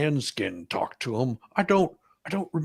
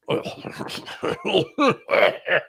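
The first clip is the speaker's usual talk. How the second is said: short breathy real laugh, pitched up; nervous laugh; 9 semitones higher than usual. Real, not acted. Witness laughs nervously admitting he is unsure, breathy chuckle. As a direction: laugh off the uncertainty.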